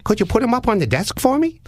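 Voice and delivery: high, sing-song creepy caller voice; odd prank caller. Retold or imitated. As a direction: play the weird caller way up high. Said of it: "And he said".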